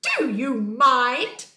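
loud, slow, stretched-out protest; indignant cry. Acted. Mole disturbed by Bear's scratching, indignant cry. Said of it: "cried a mole".

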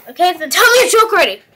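loud, high, rushed outburst; impatient demand. Acted. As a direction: hurry them up, out of patience. Host loses patience with contestant.

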